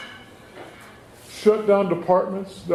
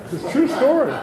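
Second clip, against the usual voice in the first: chuckling and pitched up high; laughing; 8 semitones higher than usual. Real, not acted. To the crowd, vouching for the story, laughing. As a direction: laugh as you swear it really happened.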